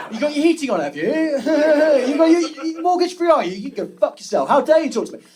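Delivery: loud, high-pitched indignant rant; mock outrage. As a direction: act affronted, pitch and volume jumping up. Acted outraged reply to bill-payer.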